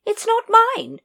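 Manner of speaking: very high, hearty lady; lady correcting Nobby, very high and hearty. A narrator's reading. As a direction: chirp it like a jolly aristocrat. Lady Ramkin.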